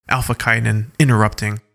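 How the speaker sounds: ordinary delivery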